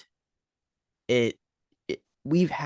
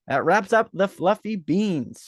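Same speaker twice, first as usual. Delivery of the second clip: mock announcer; 7 semitones higher, slower than usual. Echoing the sign-off in a voice.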